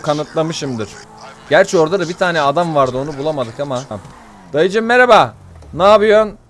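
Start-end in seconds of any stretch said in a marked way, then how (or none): 4.5-6.4 loud greeting. Real, not acted. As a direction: call out to the uncle cheerfully. Greets an old man in game. (yaşlı adama yüksek sesle seslenerek)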